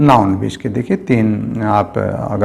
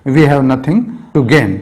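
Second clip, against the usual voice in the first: slow dictation; 3 semitones higher, much slower than usual. Shown as a demonstration. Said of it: "हमारे पास पाने के लिए कुछ नहीं है".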